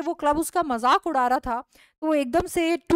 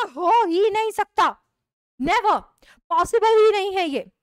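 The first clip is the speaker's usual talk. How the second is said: higher and louder, refusing to believe; loud denial; 6 semitones higher, 5 dB louder than usual. Acted. Husband to wife, denying the club mocks him, loud disbelief. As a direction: shout it can't be true. George denies being a joke.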